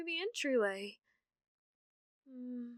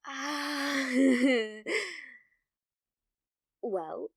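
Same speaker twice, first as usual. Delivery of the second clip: ordinary talk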